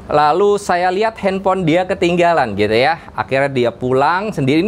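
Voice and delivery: ordinary talk, nothing marked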